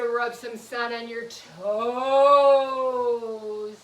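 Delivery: long, loud stretched TOES; slow right down, holding the last word; drawn-out instruction. Shown as a demonstration. Teacher leads children reaching down to their toes, drawn-out.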